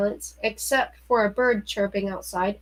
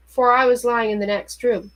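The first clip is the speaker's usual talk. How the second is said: ordinary delivery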